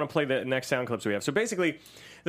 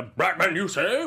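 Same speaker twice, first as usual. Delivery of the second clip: loud, higher-pitched mock cartoon villain; raised-voice villain imitation; over-the-top Riddler; 3 semitones higher, 4 dB louder, slower than usual. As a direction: ham it up like a manic villain. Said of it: "Then there's moments like the".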